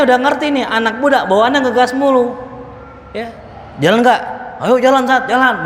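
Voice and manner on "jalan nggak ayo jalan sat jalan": lively, higher-pitched eager youth; eager youngster. Acted. Youth answering the ustaz's invitation, eager and higher. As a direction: play a youngster keen to get going. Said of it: "Begitu aja kan anak, anak muda".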